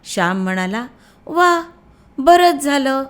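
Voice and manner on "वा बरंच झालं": higher, louder cry of joy; a boy thrilled at the promise; delighted exclamation. A narrator's reading. Shyam hears Gopal Dada will bring something, delighted.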